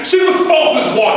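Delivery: fast, harsh accusation; angry. Acted. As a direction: spit out the accusation. Othello insists fast.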